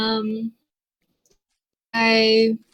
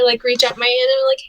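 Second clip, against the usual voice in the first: ordinary delivery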